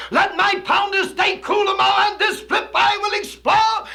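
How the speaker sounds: high, loud declaiming shout; shouted verse. Acted. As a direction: belt the lines out like a preacher. Climax of the hip Raven poem.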